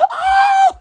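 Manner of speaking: high, loud laughing "Oh"; delighted laughing cry; laughing squeal. Real, not acted.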